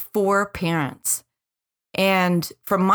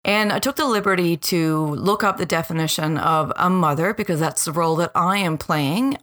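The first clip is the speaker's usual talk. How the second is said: ordinary talk, nothing marked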